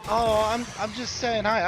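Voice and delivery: high, loud put-on woman's voice; mock innocent woman. Mocking a woman excusing herself to the dad, high and loud. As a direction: play her as falsely innocent. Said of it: "the woman's reply".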